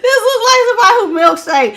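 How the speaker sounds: high, fast words through a laugh; breaking into laughter; laughing hard. Real, not acted. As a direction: crack up while saying it.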